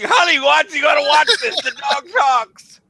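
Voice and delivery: high, very slow excited call; mock boss calling wife. Boss calling his wife to watch the dog, high and very slow. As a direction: call the wife over, astonished.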